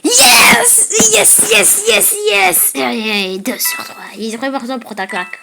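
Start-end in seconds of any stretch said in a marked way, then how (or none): 0.0-2.7 loud high victory yell; triumphant shout. Real, not acted. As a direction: celebrate the win at full voice. Wins the round.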